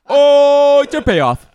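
long, high, loud delighted oh; thrilled cry. Real, not acted. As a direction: draw it out loudly with glee. Thrilled at the payoff.

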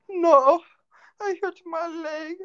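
very high, drawn-out whimpering falsetto; imitated high-pitched moan of pain; injured woman's voice. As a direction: put on a squeaky hurt-woman voice. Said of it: "obviously this woman was an American".

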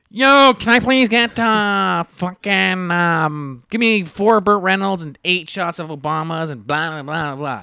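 high, loud, slow nasal drawl, easing down; nasally guy voice. Retold or imitated. Obnoxious boyfriend ordering drinks at the bar, nasally voice. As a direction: drag out the order in a nasal whine. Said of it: "just super nasally".

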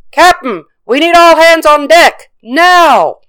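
high, loud urgent yell; yelled order. A narrator's reading. First mate demands all hands on deck, yelling. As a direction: call the crew up with a full yell. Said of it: "The first mate yelled the order".

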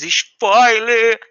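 hyped shout. Acted. Announcing spoilers to the audience.

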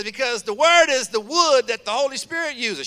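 a touch of the Southern church lady; lightly voiced old Southern lady. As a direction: lightly echo her fervent counsel. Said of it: "She says".

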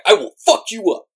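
mock threat. Retold threat from masked girl.

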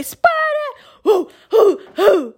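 high, drawn-out mock chant; imitating girl's cadence call. Girl calling cadence in formation, high mocking imitation. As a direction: mimic a drill call with punched hoots. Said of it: "'cause she wanna say".

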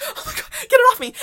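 high, loud hysterical plea; mock-hysterical cry, pitched far up; re-enacted panic attack. Sobbing daughter begging for the butterfly to be removed, panicked. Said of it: "I'm literally having a panic attack".

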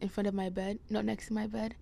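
normal talking voice

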